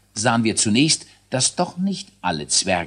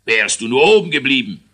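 loud furious retort; raging king; 3 semitones higher, 8 dB louder, faster than usual. A narrator's reading. King rages at the failed inventor, loud. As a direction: snap at the dwarf in fury. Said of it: "wütete der König".

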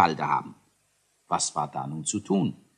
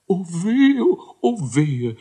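high, loud mock lament; hammed-up whining; wailing; 10 semitones higher, 7 dB louder, slower than usual. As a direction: wail like a fussy inventor in despair. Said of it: "jammerte Kakeldei".

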